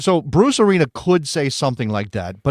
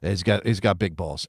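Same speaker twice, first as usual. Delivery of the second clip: imitating Bruce Arena; 5 semitones lower, 5 dB quieter than usual. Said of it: "he'd say".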